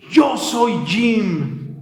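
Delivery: very slow, each word held; slow declaration. Retold or imitated. Homeless man telling Jesus his name, very slow. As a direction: draw out every word, pleading. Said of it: "Con unas fuerzas".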